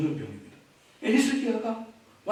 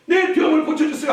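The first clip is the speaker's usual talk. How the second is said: tearful plea; 9 semitones higher, 6 dB louder than usual. Acted. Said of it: "눈물을 흘리며 통회하며".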